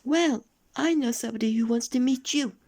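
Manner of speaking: very high, loud squeaky voice; little goblin voice. A narrator's reading. Goblin recognising Mister Uppity, very high squeak. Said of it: "said the goblin".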